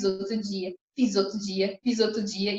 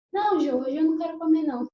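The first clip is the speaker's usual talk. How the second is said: lightly voiced husband, a bit higher and louder; husband's voice; 8 semitones higher, 6 dB louder than usual. Husband answering his wife, lightly imitated. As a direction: casually decline the food as him.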